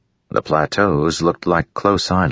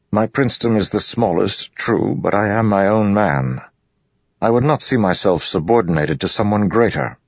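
higher-pitched, self-possessed nobleman; proud high prince; 7 semitones higher than usual. Acted. Royan refuses to be subordinated to Dalinar, proud. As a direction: stand on your dignity, pitched up.

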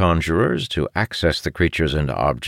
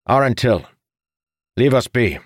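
stern order; 9 semitones higher, 4 dB louder than usual. Acted. Father orders son out after shouting.

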